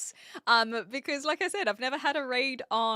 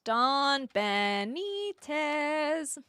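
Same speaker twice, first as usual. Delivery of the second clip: drawn-out name; much slower than usual. Shown as a demonstration.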